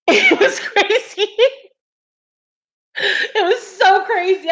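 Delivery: high, drawn-out words through laughter; laughing. Real, not acted. Streamer telling viewers about a crazy dream, laughing. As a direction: stretch it out while cracking up.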